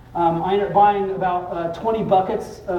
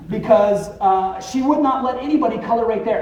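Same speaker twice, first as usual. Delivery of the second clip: ordinary delivery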